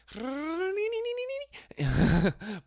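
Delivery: high, rapid "ninini" trill; mock taunting noise. Storyteller shows what he wanted to do to hostess, high babbled trill.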